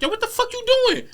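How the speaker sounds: high shocked yell; alarmed outburst. Retold or imitated. Tory seeing the shot fired, alarmed. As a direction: stop her right now, startled. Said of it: "I think Tori's like".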